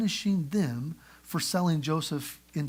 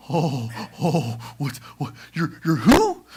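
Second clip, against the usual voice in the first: stammering shock; 2 semitones lower, 6 dB louder, slower than usual. Retold or imitated. Brothers stunned by Joseph.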